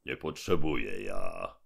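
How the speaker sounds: low, heavy robber voice; menacing giant mouth. Acted. Gębon answers the robots, low and heavy. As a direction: boom down from above.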